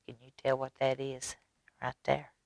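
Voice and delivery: soft aside. Real, not acted. Narrator aside to children.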